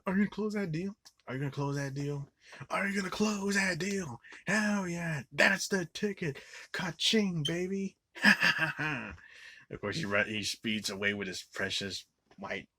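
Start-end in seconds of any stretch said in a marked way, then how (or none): 0.1-8.0 high, pumped-up salesman voice; imitating Kenny the Closer. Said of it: "this is Kenny the Closer that's asking". 8.2-9.2 loud high laugh; burst of laughter. Real, not acted. Laughing at own impression.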